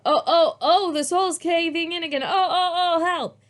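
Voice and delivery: high, loud playful cries of help; a child crying oh oh help in pretend danger; hammed-up distress; mock panic. A narrator's reading.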